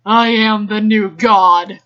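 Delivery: slow, loud grand declaration; grandiose proclamation. Acted. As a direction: proclaim it like a god.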